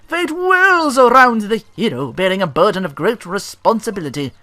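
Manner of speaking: high, slow, grand storybook announcer; epic game narrator. Acted. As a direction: proclaim the hero's quest like a fantasy trailer. Reading game intro text aloud.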